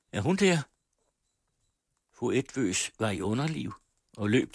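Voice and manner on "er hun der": high, raised question; calling out. A narrator's reading. Mother calling out, high and raised. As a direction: call out to find her. Mother calls for her daughter.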